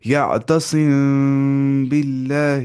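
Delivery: "یعتصم باللہ" drawn out very slowly, the م of یعتصم hidden in a nasal hum before ب; teacher recites "یعتصم باللہ" slowly for students to copy, long nasal hum on the م; recited with ikhfa. Shown as a demonstration. Teacher models the ikhfa in "یعتصم باللہ" for students, slow nasal hum. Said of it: "دھیان سنیے میری آواز".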